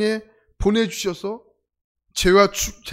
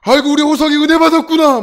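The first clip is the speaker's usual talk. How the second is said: much higher, louder delighted cry; overjoyed teacher; 9 semitones higher, 12 dB louder than usual. Retold or imitated. Teacher thrilled the boy is finally crying, exultant cry. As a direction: gush with thrilled joy. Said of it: "선생님이 난리가 난 거예요".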